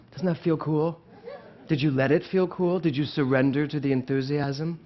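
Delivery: normal talking voice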